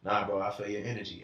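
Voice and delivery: a low, hushed, half-mumbled bro impression; imitating his friend. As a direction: drop low and mumble it like a laid-back buddy. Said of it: "He can tell me like".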